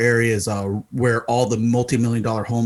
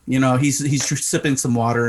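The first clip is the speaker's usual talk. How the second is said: ordinary delivery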